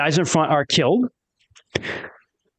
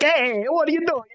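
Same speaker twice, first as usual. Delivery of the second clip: Italian caricature, pitched way up; Italian accent; 12 semitones higher than usual. Shown as a demonstration. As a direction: put on a playful Italian accent. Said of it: "I wanna have Italian lunars eventually".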